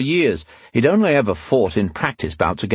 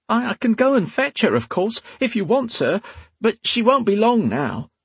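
very high, stammering maid voice; high-voiced, timid servant girl; frightened serving maid; 13 semitones higher than usual. A narrator's reading. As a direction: plead in a high whine, hurrying to placate.

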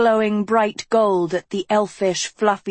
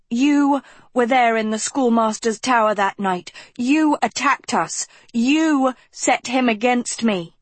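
higher, heated accusation; spewing anger; 6 semitones higher than usual. A narrator's reading. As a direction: pour the blame out hot. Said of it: "Agatha spewed at Philip".

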